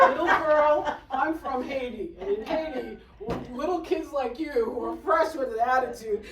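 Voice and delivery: loud, acted telling-off; indignant scolding. Woman scolding a fresh teenage girl, loudly indignant. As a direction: scold loudly, putting a kid in their place. Scolding the sister for her attitude.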